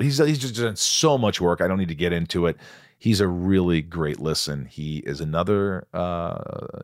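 ordinary delivery

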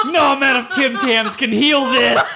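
slow, loud laughing groan. Real, not acted. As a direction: laugh it out, horrified. Horrified laughing reaction.

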